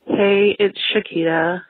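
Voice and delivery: normal talking voice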